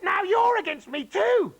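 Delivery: very high, loud aggrieved shout. Acted. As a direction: accuse the daughter loudly. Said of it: "shouted Grandma".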